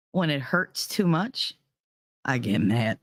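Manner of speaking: ordinary talk, nothing marked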